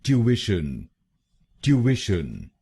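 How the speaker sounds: ट्यूशन as "tyoo-ish-un"; British pronunciation. Shown as a demonstration. Teacher joining the syllables into British ट्यूशन. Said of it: "तो बनेगा".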